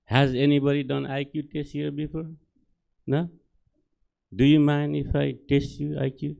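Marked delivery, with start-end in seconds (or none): none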